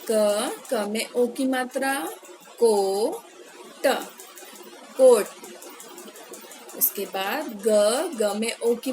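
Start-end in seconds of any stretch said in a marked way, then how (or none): 2.6-3.9 drawn-out reading. Shown as a demonstration. Teacher modelling कोट for children, very slowly. 5.0-5.3 loud crisp repeat. Shown as a demonstration. Teacher giving children the word कोट, loudly.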